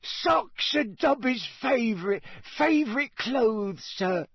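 high, slow, savouring elf voice; delighted squeak. A narrator's reading. Dobby receives socks from Harry, delighted squeak. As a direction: squeak with delight, lingering on words. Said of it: "Dobby was utterly delighted".

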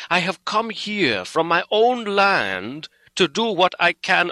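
impassioned, sorrowful appeal, pitch rising; full of pity. A narrator's reading. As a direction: plead earnestly, building in fervour. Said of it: "went on with a voice full of pity".

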